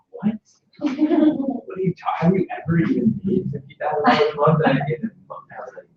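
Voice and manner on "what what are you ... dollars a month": incredulous mentor. Retold or imitated. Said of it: "And he was like".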